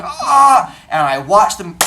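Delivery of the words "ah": sharp high mock yelp of alarm; shocked yell. Teacher yelps from the doorway at colliding kids, high alarmed cry. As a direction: yelp as two kids are about to collide. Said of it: "I'm standing in the doorway going".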